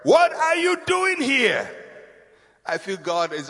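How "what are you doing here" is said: high, drawn-out question; God's challenge. Acted. Preacher voicing God's question to Elijah, challenging. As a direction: put it slowly, pitched well up.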